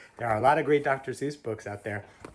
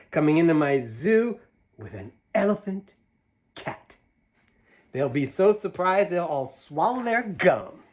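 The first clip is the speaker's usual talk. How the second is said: higher, louder, bouncy rhyme reading; reading to children; 5 semitones higher, 5 dB louder than usual. Acted. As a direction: read it big and playful for a child. Reads picture book aloud.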